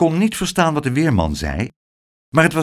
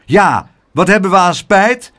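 loud, raised protest; indignant calling out; 6 semitones higher, 6 dB louder than usual. A narrator's reading. Other neighbours echoing the complaint, loud indignant call. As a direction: chime in crossly with the crowd. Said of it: "riepen de anderen".

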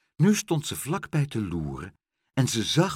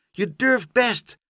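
calling encouragement; 13 semitones higher, 6 dB louder, slower than usual. Acted. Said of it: "riep Pluk".

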